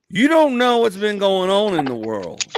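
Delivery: high, loud and drawn-out; exasperated disbelief. Retold or imitated. Comedian to out-of-the-loop wife, loudly incredulous.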